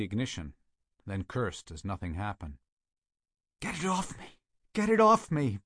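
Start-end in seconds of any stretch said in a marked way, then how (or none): 3.6-5.6 high, pained begging cry; agonized moaning plea. A narrator's reading. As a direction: beg in agony. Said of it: "Burgess moaned".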